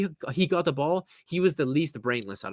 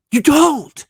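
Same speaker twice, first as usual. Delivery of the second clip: much higher, louder shout; 13 semitones higher, 11 dB louder than usual. Acted. Fan yelling at Sané to stop asking for fouls. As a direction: yell "You don't" right at him.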